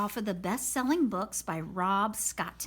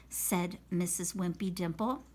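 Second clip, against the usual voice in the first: ordinary delivery, nothing marked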